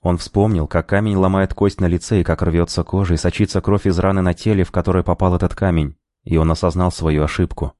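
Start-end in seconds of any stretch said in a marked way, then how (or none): none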